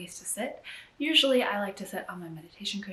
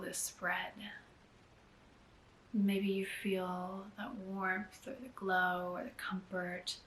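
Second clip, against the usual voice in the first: unhurried, hushed meditation lead; guided meditation. Acted. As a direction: gently guide them inward, leaving space between phrases. Leads a guided gratitude meditation.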